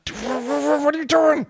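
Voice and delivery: high, disbelieving what are you doing; shocked friends cutting in; incredulous. Retold or imitated.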